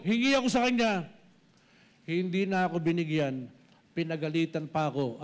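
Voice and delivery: normal talking voice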